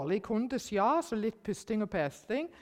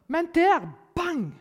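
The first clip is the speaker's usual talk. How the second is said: loud, high burst on bang; excited exclamation; 10 semitones higher, 10 dB louder, slower than usual. Real, not acted. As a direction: land bang like a sudden catch. Dramatic moment of the catch. (høy, begeistret utbrudd)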